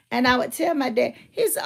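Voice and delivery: ordinary talk, nothing marked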